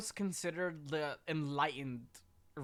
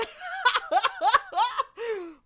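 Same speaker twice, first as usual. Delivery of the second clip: loud high cackle; high laughing fit; 12 dB louder than usual. Real, not acted. Streamer losing it over the catty cards, laughing fit. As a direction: let the laugh burst out high.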